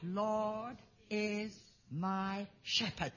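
The Lord is my shepherd, slow and soft, each word drawn out; slow soft recitation. Shown as a demonstration. Preacher leading the congregation in the verse, slow and soft. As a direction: lead the congregation gently and slowly. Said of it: "so can you do that with me now".

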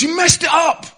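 high, very loud yelled reply; acted girl shouting over noise; shouted accusation. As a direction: yell the blame over a roaring crowd.

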